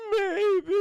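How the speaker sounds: high, loud singsong tease; hammed-up teasing movie villain; Doctor Lecter. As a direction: draw it out in a silly coy squeal. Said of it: "He goes".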